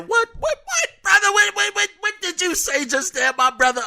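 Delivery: high, squeaky what-what-what of shock; sudden falsetto double take; stunned disbelief. Real, not acted. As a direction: jump way up in pitch like you can't believe it. Shocked at the email's wording.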